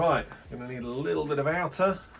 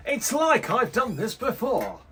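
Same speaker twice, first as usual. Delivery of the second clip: mock smug; 11 semitones higher, 6 dB louder than usual. Self-praise joke.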